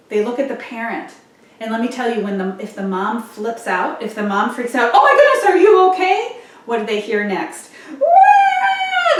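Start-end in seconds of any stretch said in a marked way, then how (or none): 4.9-6.4 high, loud alarmed squeal; shrill-free panicky mom cry; panicked mom. Retold or imitated. Mom freaks out over fallen toddler. 8.0-9.2 high loud imitated baby cry, half laughing; playful imitation of a crying baby; mock baby wail.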